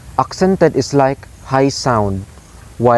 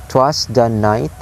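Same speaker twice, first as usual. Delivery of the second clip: "'twas the night" slowly, three beats, NIGHT rising; anapestic stress. Shown as a demonstration. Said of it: "low, low, high".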